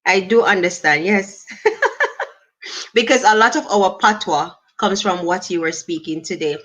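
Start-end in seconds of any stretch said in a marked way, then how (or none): 1.5-2.2 quick, high, rising giggle; high laugh. Real, not acted. Host laughing at a viewer's question, high giggle.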